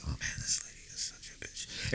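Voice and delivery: whispered insult muttered off mic; whispering under the breath about the customer; under your breath. Retold or imitated. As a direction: whisper it so she can't hear. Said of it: "under your breath".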